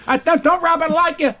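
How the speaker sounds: high, prying question; a lightly voiced nosy fan; imitating nosy people. Fans asking about De Niro, high prying voice. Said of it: "I have people say".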